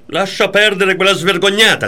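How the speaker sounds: loud, high retold tirade; mock jealous yell. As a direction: throw her accusation out sharply.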